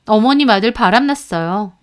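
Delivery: usual speaking voice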